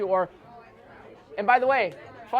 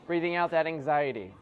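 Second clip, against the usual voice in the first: guided relaxation; 3 semitones lower than usual. Acted.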